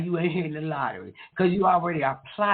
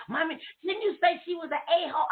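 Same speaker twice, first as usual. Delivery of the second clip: put-on child voice; 10 semitones higher, faster than usual. Son's question voiced in story.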